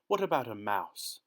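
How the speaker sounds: plain reading voice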